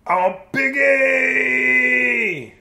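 a slow, stretched, high shout; yelling character. Acted. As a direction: bellow it out long and proud. Character yells in story.

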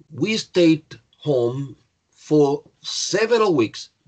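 very slow, word by word; drawn-out, careful teacher's example; slow model sentence. Shown as a demonstration. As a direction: separate each word for learners.

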